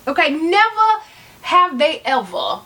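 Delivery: "never" drawn out slowly and hit hard; stress on never. Acted. Telling judgers luxury and sense never mix, stressing never. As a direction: lean on "never", slow and pointed. Emphatic punchline after Jeopardy joke.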